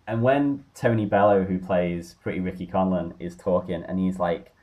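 ordinary talk

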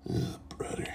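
voiceless, weary whisper; exasperated whisper. Real, not acted. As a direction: sigh it out under your breath. Exasperated at the meme.

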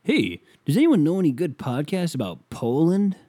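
high, eager mocking voice; a Reddit poster asking for Poland podcasts, mocking. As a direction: read it like a clueless earnest poster. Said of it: "motherfuckers were like".

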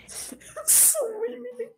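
high squeaky laughing line. Real, not acted. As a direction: crack up while repeating it. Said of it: "Eu sabia que ele ia rir".

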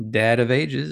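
ordinary talk, nothing marked

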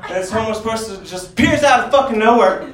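ordinary talk

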